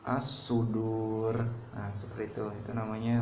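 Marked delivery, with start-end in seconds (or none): 0.1-1.3 As-sudur slow, the long u held before the final r; lengthened stop at end. Shown as a demonstration. Teacher shows mad arid li sukun, slow recitation. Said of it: "Ada lagi contoh lain".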